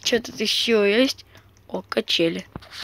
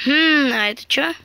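ordinary talk, nothing marked

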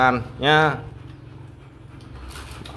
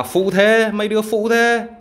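mock indignant; 10 semitones higher, 4 dB louder than usual. Real, not acted. Mock complaint at dogs.

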